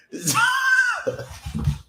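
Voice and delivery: high, loud whooping laugh; shouted laugh. Real, not acted. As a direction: howl with laughter. Laughing after winning joke.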